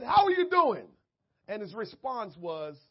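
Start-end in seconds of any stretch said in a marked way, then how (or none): 0.0-0.9 loud, high, bright call; booming greeting. Retold or imitated. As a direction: belt out the greeting. Retold greeting, loud take.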